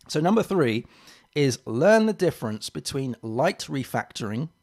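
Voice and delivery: ordinary delivery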